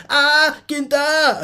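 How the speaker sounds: cri aigu et traînant d'inquiétude; inquiète. Retold or imitated. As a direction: appelle Kinta, très haut et lentement. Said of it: "Inquiète, quoi". (appel aigu et inquiet vers Kinta)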